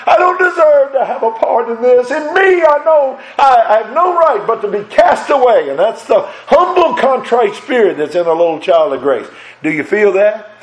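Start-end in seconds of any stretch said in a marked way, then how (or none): 0.1-5.7 high, pleading cry of unworthiness; impassioned confession. Retold or imitated. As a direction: plead it higher and louder, overwhelmed. Preacher voicing unworthy believer.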